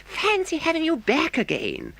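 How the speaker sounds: a beaming mole cheering a friend; Mole crying out. A narrator's reading. Mole greets returned Toad, joyful cry. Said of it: "his face beaming".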